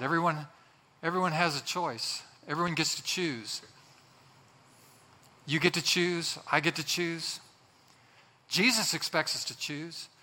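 ordinary talk

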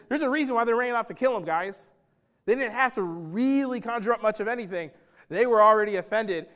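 ordinary talk, nothing marked

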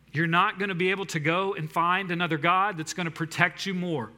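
ordinary talk

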